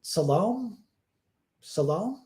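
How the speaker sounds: Salome said suh-LOME, rhyming with home; questioning suh-LOME, final e silent; mispronounced Salome. Retold or imitated. Actress asks how the name goes, Salome as questioning suh-LOME. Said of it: "How do you pronounce".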